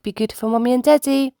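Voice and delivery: ordinary delivery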